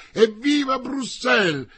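high, loud crowd-style cheers; cheering shouts. A narrator's reading. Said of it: "Poi risuonarono le grida".

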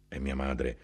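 usual speaking voice

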